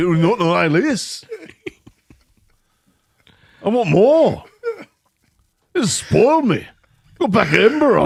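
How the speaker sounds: hammed-up Brit gawping at huge portions; mock British amazement. Mocking a British fan amazed at American portions, hammed-up high voice.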